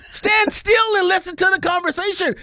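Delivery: high-pitched exasperated shout; frustrated yelled command; exasperated order. Acted. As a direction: order the streamer about at the top of your range. Ranting at absent streamer's character.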